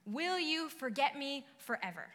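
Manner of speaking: loud, impassioned psalm reading; lamenting psalm read with force; scripture reading. Shown as a demonstration. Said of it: "verses one and two say".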